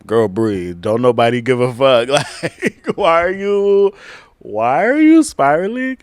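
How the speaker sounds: loud, high, laughing rebuke to herself; laughing self-scold. Acted. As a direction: shake yourself out of the spiral with a laugh. Scolding herself over anxiety.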